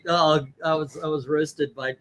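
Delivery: normal talking voice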